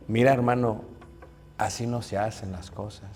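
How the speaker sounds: soft, low, gentle reproof; calm correction. Acted. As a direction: correct a brother meekly. Meek brother correcting calmly.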